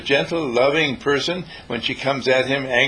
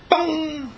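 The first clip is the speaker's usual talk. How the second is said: bell-like bong. Retold or imitated. Imitated sound of sudden change.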